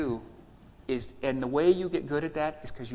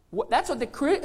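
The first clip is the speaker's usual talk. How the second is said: ordinary delivery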